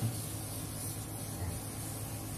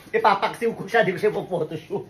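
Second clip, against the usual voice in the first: joking through giggles, higher; laughing; 5 semitones higher than usual. Real, not acted. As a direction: laugh the words out.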